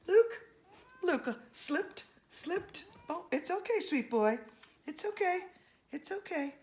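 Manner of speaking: tender comforting. Acted. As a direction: soothe him like a fallen child. Comforting a chimp who slipped.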